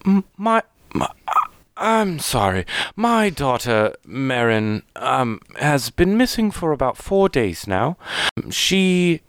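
high, halting voice of a tearful mother; acted distraught elven woman, faltering. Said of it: "she seems very distraught, uh, like she's been crying".

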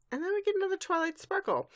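fast, high burst of excitement; excited reveal. Real, not acted. To co-host, reveals the twist excitedly. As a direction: blurt out the big reveal. Dramatic reveal of second Twilight.

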